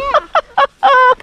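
high burst of real laughter; delighted laugh. Real, not acted. Father delighted at child's shot.

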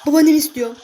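loud excited shout. Real, not acted. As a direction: yell it out over the game. Oyunda heyecanla bağırıyor. (heyecanlı yüksek bağırış)

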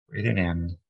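calm, even meditation voice with long pauses; unhurried, soothing relaxation lead; guided meditation. Acted. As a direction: guide them gently through breath and body, leaving room between phrases.

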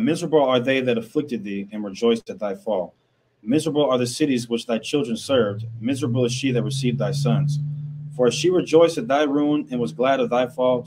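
loud, declaiming Bible verses to the room; scripture reading. Acted. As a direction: proclaim each verse like from a pulpit. Reads scripture aloud.

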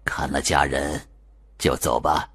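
softly murmured into her ear; quiet murmur. Acted. Liu murmurs to the possessed old woman, soft and low. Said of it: "小声嘀咕一句".

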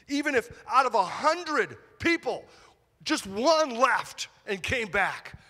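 rising to a fervent high; fervent preacher lift; excited. Acted. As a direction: swell with passion, slowing on the last words. Preacher building passion.

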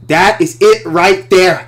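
a sudden eureka, loud and drawn out; thrilled shout. Retold or imitated. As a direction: shout it out like you found gold. Retells thrilled discovery on set.

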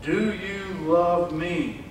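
very slow, drawn-out question. Acted. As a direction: stretch every word searchingly. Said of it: "This is a deeper question".

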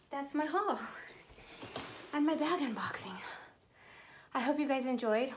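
breathless, puffing between phrases; out of breath. Real, not acted. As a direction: say it winded, catching breath. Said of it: "Why am I out of breath?".

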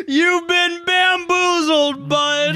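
very high, drawn-out prank reveal; gleeful taunt. Pretend. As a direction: crow it slowly at a fooled friend.

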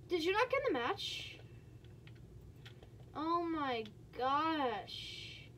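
ordinary talk

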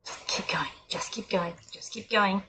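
hushed, low whisper. Real, not acted. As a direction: sneak past, whisper it. Sneaking quietly past.

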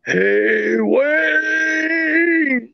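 high, long drawn-out call; imitated calling out. Imitating what the spirit box picked up, high drawn-out call. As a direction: mimic a distant voice calling a name, stretched high. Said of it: "and then you hear".

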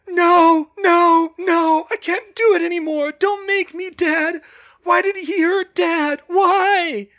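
screamed begging, very high and loud; desperate, pleading scream. A narrator's reading. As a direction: scream in terror like someone younger. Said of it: "then desperate and pleading".

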